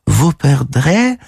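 high, slow French twitter; щебет мадемуазель Бланш. Acted. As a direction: chirp it high and drawn out. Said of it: "защебетала мадемуазель Бланш". (высокий протяжный щебет по-французски)

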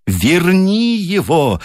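high, slow, desperate cry; a mother demanding her son back. A narrator's reading. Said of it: "закричала миссис Уайт".

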